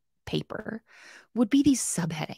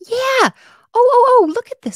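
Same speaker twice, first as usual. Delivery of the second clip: excited; 10 dB louder than usual. Real, not acted. Excited at a viewer's point.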